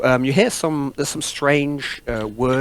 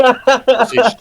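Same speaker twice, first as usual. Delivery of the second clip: announcing; 11 semitones higher, 9 dB louder than usual. Acted. GM announces the creature.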